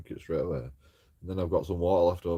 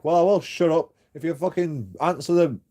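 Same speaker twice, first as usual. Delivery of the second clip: higher, louder furious yell through the door; angry retort; 6 semitones higher, 5 dB louder than usual. Retold or imitated. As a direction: shout back in frustration. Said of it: "And I'm like".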